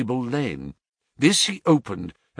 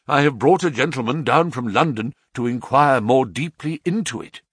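ordinary delivery

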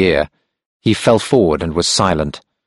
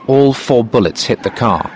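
usual speaking voice

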